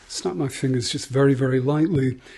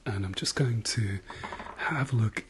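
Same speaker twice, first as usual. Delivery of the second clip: whispering; 2 semitones lower, 6 dB quieter than usual. Acted. ASMR doctor exam roleplay.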